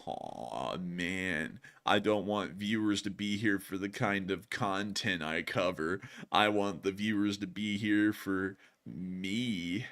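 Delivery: low, slow, quiet marmot voice; mock-pleading, drawn-out put-on voice; marmot character. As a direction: mope softly and slowly as a marmot. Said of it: "he's down here going".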